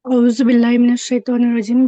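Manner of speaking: औज़ु बिल्लाहि मिनश शैतानिर रजीम rattled off fast and loud; rushed recitation. Real, not acted. Student rushes before teacher, fast. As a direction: race through it. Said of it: "इत्मीनान से".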